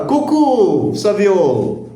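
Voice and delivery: excited. Shown as a demonstration. Excited demo of greeting.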